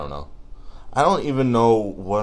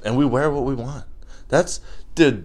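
normal talking voice

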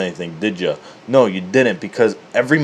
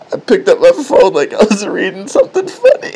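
high, loud, breaking up laughing; real laughter; 10 semitones higher, 7 dB louder than usual. Real, not acted. Confessing fake phone reading, laughing hard. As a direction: push the words through helpless laughter. Cracking up at own story.

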